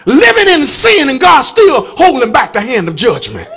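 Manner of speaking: loud, rising gospel preaching; full-force preaching. Acted. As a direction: preach it hard to the congregation.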